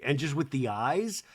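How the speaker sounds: ordinary talk, nothing marked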